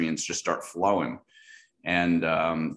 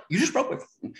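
accusing. Retold or imitated. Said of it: "that hairy side eye".

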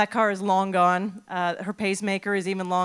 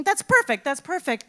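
high, loud burst of glee then settling; delighted with the plan; gleeful; 9 semitones higher, 6 dB louder than usual. Retold or imitated. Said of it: "and I'm thinking".

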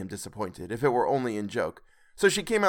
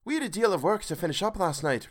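put-on girl's voice; 5 semitones higher than usual. A narrator's reading. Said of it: "replied the girl".

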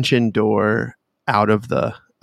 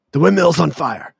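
higher-pitched call of alarm; brother's alarmed call; 7 semitones higher than usual. Acted. Game master as brother warning of fire, alarmed call. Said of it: "you hear your brother say".

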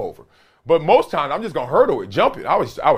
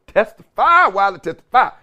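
hype testify; 6 semitones higher, 4 dB louder than usual. Real, not acted. Hyping his own saying.

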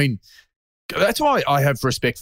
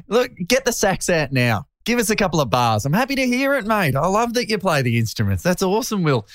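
mock encouraging; 9 semitones higher than usual.

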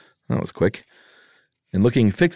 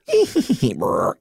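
dwarf laugh; 2 semitones higher than usual. Acted. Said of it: "Hereupon the dwarf laughed".

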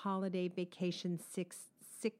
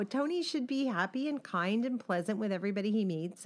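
ordinary delivery

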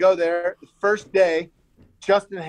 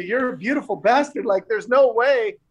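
pitched up, laughing through the praise; gushing compliment; 6 semitones higher, faster than usual. Retold or imitated. TJ praising his friend's looks, pitched-up gushing with laughter. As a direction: gush over him with a laugh.

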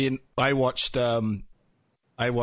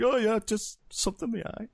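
high, giggly cover-up; laughing fake excuse; 11 semitones higher than usual. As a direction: laugh off the tears. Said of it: "I was like".

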